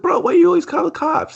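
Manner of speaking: high-pitched whiny complaint; high mock exasperation. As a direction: squeak it out in disbelief. Friends scolding snitch Leo.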